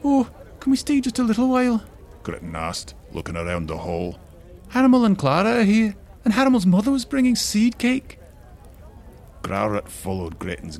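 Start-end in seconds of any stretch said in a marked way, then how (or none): 0.0-1.8 put-on little girl voice. Granddaughter pleading. 4.7-8.2 put-on little girl voice. Granddaughter speaking.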